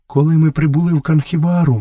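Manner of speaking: high, timid woman's voice; a touch of fearfulness. A narrator's reading. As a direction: speak up fearfully, pitched high. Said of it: "боязко промовила Месуа".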